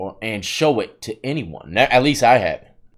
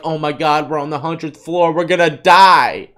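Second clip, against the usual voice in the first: mock panic; 3 semitones higher, 6 dB louder than usual. Voices movie characters' panic on waking.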